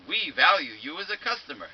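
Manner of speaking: mocking a recorded hold message; automated hold message voice. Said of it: "you hear the automated voice saying".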